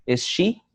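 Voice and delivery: SHE lifted high as a question; rising question intonation. Shown as a demonstration. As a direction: ask it, pitch climbing on SHE. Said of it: "my pitch got higher".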